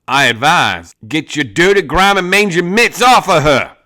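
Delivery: loud, rising cowboy threat; a hard outlaw telling the gang to back off; Tommaso's warning. Acted. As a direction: warn them off her.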